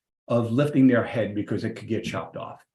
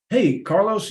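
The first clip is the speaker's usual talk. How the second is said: friendly call; 8 semitones higher, 5 dB louder than usual. Retold or imitated. Calling out to mail guy.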